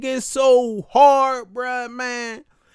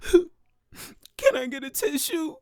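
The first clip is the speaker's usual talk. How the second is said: slow, tearful mock whimper; mock crying plea; 5 semitones higher, slower than usual. Asking the doctor for a tissue, mock tearful whimper. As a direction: ask through put-on sobs. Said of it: "I'm boo-hoo crying".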